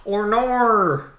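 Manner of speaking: high, loud, stretched Australian "Or nor". Shown as a demonstration. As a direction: wail "Or nor" as an over-the-top Australian. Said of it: "like the stereotypical Australian accent".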